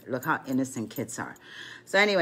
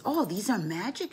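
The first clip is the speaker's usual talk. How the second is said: high imitation of an amazed child; a hint of childlike awe; wondering grandson; 8 semitones higher than usual. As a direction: lightly voice a little boy's wonder.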